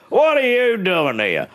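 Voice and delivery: mock startled cry; shocked homeowner. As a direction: blurt it out in shock.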